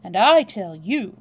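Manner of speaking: loud, slow, emphatic comeback; weighty, loud retort. A narrator's reading. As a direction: throw it back forcefully. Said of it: "retorted Julius".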